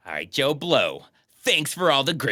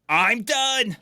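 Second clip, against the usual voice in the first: higher-pitched imitation of a shouted retort; half-yelled, pitched-up mimicry; mock yelled comeback; 9 semitones higher than usual. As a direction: throw it back like a childish comeback. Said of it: "conversation with the yelling".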